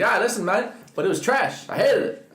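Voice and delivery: high, bright Irish voice; Irish accent. Retold or imitated. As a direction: imitate an Irish caller. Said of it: "he's like".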